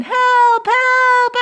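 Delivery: high, loud cry for help; calling for help. Acted. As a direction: call out urgently in a high voice. Boy calls policeman in emergency.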